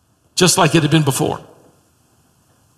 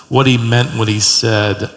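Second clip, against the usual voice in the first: usual speaking voice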